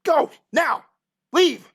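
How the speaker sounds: high, loud single-word commands; shouted order. Acted. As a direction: drive them off with separate shouts. Farmers threaten players with pitchforks.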